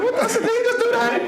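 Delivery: very high, laughing disbelief; high shocked squeak. Retold or imitated. Host seeing the faker open one eye, shocked squeal. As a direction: gasp it out in disbelief. Shocked at fat dude faking.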